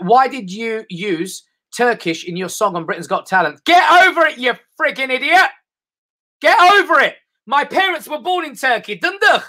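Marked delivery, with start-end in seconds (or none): 3.7-9.4 much higher, loud exasperated yelling; mock furious. Comedian snapping at a fan's question about Turkish, furious. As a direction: blow up at the questioner. Angry reply to heckling question.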